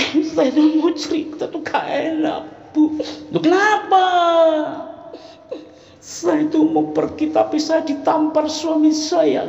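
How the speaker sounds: slow, high, awkward confession; confiding friend. Acted. Friend confiding to the hostess about her husband, slow and awkward. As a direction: hesitate and laugh it off. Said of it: "didatangi teman-teman".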